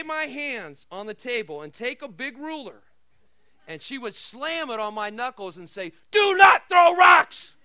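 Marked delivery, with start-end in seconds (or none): 6.1-7.6 a loud, slow, stern shout of a mother; yelling mother. Retold or imitated. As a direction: bark it out like an angry mom. Mom yelling while slamming ruler.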